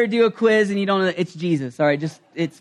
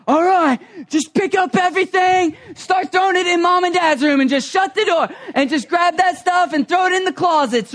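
urgent shouted orders; 10 semitones higher than usual. Retold or imitated. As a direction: bark orders to clean fast. Rushing kids to clean.

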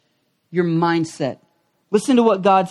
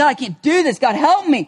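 pleading prayer; 7 semitones higher, faster than usual. Retold or imitated. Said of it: "You're like".

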